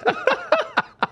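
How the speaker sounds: high-pitched amused laughter; high giggling laugh. Real, not acted. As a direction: laugh at your own story. Laughing after telling his story.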